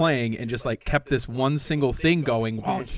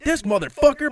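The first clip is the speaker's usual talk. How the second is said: high, loud mock outrage; imitating angry singer; 12 semitones higher, 5 dB louder than usual. Mimicking the band's singer calling out a fan, high and loud. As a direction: ham up the singer's indignant jab.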